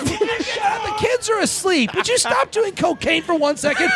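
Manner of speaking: high-pitched mock furious wife; wife scolding. Wife with kids asleep scolds husband, high yell.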